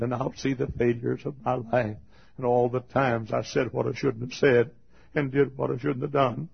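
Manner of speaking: ordinary talk, nothing marked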